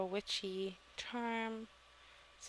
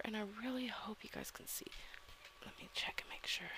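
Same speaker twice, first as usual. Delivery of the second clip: whispering; 8 dB quieter, faster than usual. Real, not acted. Talking quietly, kids asleep.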